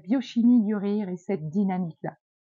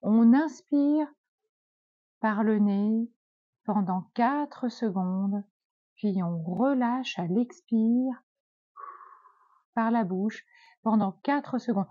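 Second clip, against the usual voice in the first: slow breathing guide; slower than usual. Shown as a demonstration. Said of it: "Et vous allez faire ça".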